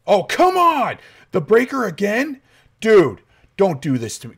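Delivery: high, loud exasperated complaint; frustrated. Real, not acted. Gamer annoyed the breaker is off again. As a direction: groan it at the game.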